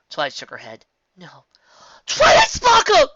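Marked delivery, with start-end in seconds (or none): 1.1-1.4 quiet no. A narrator's reading. Quiet refusal. 2.1-3.1 loud slow roar, high. A narrator's reading. Rarity roars at Twilight through the door. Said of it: "Rarity roared".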